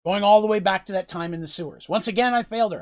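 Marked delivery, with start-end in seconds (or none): 1.9-2.8 louder, higher lament; anguished self-blame. Acted. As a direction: throw the blame on yourself. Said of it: "all he sees are the failures".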